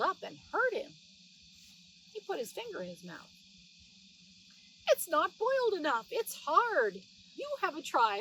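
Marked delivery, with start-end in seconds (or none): 4.9-8.2 higher, louder mouse voice; indignant mouse grumbling at the hard ham; Tom Thumb complaining. Acted. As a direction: squeak out the grumble as a cross little mouse.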